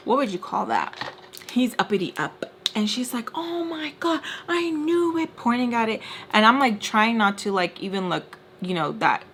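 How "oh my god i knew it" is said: high-pitched mock outrage; retold outraged accusation. Friend pointing at him, high-pitched mock outrage. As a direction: imitate her gotcha moment, pitch way up.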